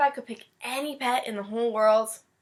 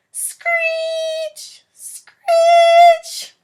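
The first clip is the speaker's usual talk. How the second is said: high, loud drawn-out screech; mock screeching noise; 13 dB louder than usual. At night a noise wakes the boy, loud screech. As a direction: screech like nails on glass.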